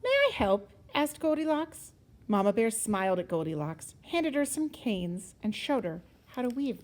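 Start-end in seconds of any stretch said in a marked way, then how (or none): 0.0-0.7 put-on high, eager child voice. A narrator's reading. Goldilocks offers Mama Bear help, high girlish voice. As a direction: pitch it up as a small girl offering.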